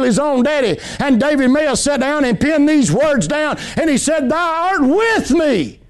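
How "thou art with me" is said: slow, drawn-out reverent quote; solemn psalm line. Acted. Preacher quoting David to his flock, slow and reverent. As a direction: lift it up slowly, savoring each word.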